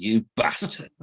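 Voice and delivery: a northern "You bastard." with flat vowels, short a in "bastard"; northern actor's snarled "You bastard.", flat short a; Sean Bean impression. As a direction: spit out "You bastard." like a gruff Yorkshire film hero. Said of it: "sound like Sean Bean".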